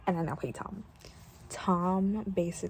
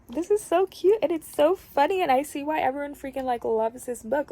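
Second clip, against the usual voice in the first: excited, higher and louder; giddy enthusiasm, pitched up; gushing; 5 semitones higher, 5 dB louder than usual. Real, not acted. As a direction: gush with delight over a favorite book. Gushing about the book.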